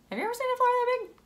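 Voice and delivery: high, very fast, eager ask; excited question. A narrator's reading. Reading to kids, eagerly asks the children about the flower. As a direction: dare them to imagine it.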